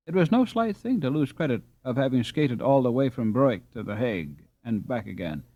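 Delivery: ordinary delivery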